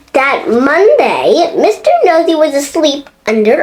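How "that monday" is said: ordinary delivery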